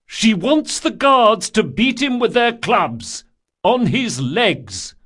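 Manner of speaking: high, loud announcing shout. A narrator's reading. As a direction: call it out to the arena. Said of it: "He cleared his throat and shouted".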